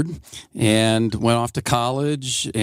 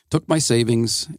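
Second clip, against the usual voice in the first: normal talking voice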